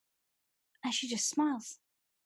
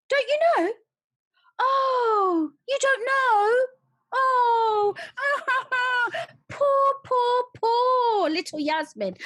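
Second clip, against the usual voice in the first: higher, louder sneering fake sobs; jeering at poor little Yasmin with hammed-up crying; mock crying; 13 semitones higher, 8 dB louder, slower than usual. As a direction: wail it in mock pity.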